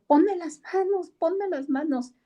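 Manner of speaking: high, loud insistent begging; pleading drunk woman. Retold or imitated. Drunk woman begs the preacher for hands, high pleading. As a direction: beg her to lay hands on you.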